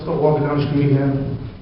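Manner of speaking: usual speaking voice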